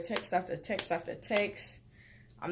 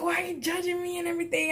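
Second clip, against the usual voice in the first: high, fast whiny complaint; whiny boyfriend quote; 10 semitones higher, much faster than usual. Retold or imitated. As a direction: rattle off his whining in a high voice.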